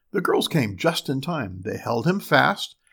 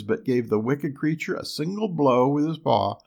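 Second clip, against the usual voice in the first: plain reading voice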